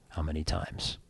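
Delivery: hushed low confessional voice; low quiet question. Acted. Rabbi copies the priest's hushed confession question, low and soft. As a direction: keep it low and soft behind the screen.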